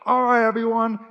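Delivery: loud, high call to the crowd; hyped MC call. Retold or imitated. MC hushing the crowd, loud and high. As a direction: grab the room like an MC. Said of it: "they were like".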